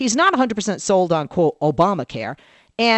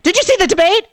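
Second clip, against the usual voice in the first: eager question; 13 semitones higher, 6 dB louder than usual. Retold or imitated. Husband eager about debate.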